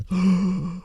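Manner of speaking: sharp high gasp; quick intake of surprise; high, quiet gasp. Real, not acted. Reacts to stopped and rising numbers.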